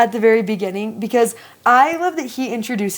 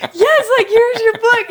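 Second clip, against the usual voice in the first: much higher, laughing through the words; giggling as she hands over the book; the leader laughing at the boy's mix-up; 11 semitones higher, 4 dB louder than usual. Retold or imitated.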